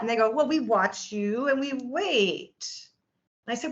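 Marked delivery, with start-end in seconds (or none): 0.3-2.8 slow, stretched-out doctor's explanation; drawn-out explaining. Retold or imitated. Doctor explaining watchful waiting to patient, slow and drawn-out. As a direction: spell it out very slowly, stretching each phrase.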